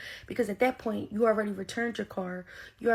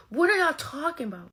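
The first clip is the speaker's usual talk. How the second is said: outraged disbelief; 6 semitones higher, 6 dB louder than usual. Real, not acted. Outrage at bribe demand.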